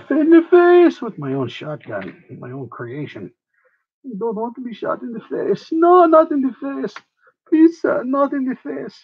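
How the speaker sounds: high mock begging, hammed up; high-pitched hammy plea; mock pleading. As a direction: whine like a scared victim. Begging not to be shot.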